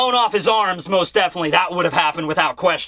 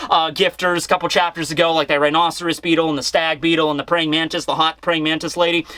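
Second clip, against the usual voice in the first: usual speaking voice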